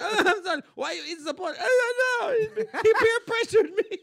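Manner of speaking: put-on high, wailing child; hammed-up tearful confession; mock tearful kid. Kid confessing to mom, mock crying.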